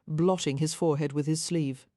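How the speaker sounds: usual speaking voice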